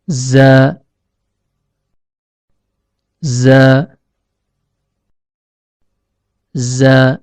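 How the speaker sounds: "za" with a long buzzing z and held vowel; Arabic letter zai. Shown as a demonstration. Teacher demonstrates the letter zai for learners, "za" buzzed and held. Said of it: "keluar dari ujung lidah yang bertemu".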